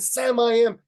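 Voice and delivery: high, loud class yell; gleeful full-voiced holler; kids' shouting. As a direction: shout it along with a rowdy class. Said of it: "the kids are shouting".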